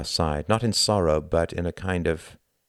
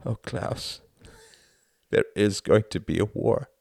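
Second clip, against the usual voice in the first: choked, breathy voice breaking; breaking cry; 5 semitones higher than usual. A narrator's reading. Tom breaking down to Klaus about war. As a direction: let the voice break. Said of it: "at his cry".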